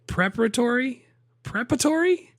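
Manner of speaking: asking back, baffled by the word; high, amazed double-take at the word; incredulous. Real, not acted. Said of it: "he even put a different emphasis".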